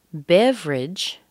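"beverage" slow, as BEV-ridge; missing syllable. Shown as a demonstration. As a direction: drop the second e of "beverage", drawn out. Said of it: "we're missing another syllable".

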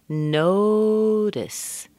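"Notice" with long, high, strong NO and weak schwa ending; primary and weak stress. Shown as a demonstration. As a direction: stretch the first syllable of "Notice", drop the second. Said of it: "primary stress and a weak stress".

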